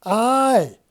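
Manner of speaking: high loud yelled aye; scream. Acted. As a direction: cry it out in alarm. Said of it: "screams Dixon".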